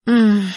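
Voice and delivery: wordless "mmm" moan. A narrator's reading. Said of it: "громкий стон Ассирии".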